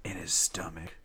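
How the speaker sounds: whispering. Real, not acted. Telling a secret detail.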